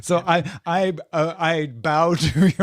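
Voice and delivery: talking through a laugh. Real, not acted. Co-host conceding a point about dating, laughing as he speaks. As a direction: let the laugh break into the words. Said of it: "That's why I'm laughing".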